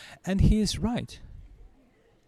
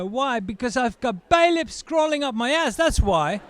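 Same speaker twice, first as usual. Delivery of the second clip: exasperated retort; 7 semitones higher than usual. Acted.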